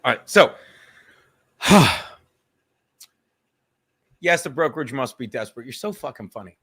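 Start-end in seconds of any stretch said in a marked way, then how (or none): 1.6-2.1 big, heavy exhale; loud sigh. Real, not acted. Streamer getting back to the show, loud sigh. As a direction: let out one loud sighing breath.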